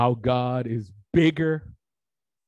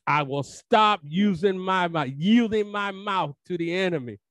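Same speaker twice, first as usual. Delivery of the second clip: preaching at full force; 6 semitones higher than usual. Acted. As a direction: push it up like a fired-up preacher. Sermon rising repetition.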